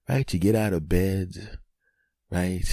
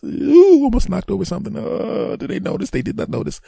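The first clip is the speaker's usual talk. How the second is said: startled hush; 4 dB louder than usual. Acted. Sneaking past kids, near miss.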